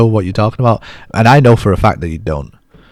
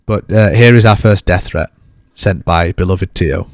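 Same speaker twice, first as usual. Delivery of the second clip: ordinary talk, nothing marked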